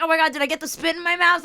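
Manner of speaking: high, fast frightened babble; panicked. Retold or imitated. Woman spat on, gasping her fear fast and high. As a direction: rattle it off in disgusted panic. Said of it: "I'm all like".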